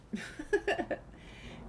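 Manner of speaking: quick unvoiced laugh rising at the end; breathy embarrassed laugh. Real, not acted. Player laughing at picking the wrong dialogue option. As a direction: laugh off the mistake.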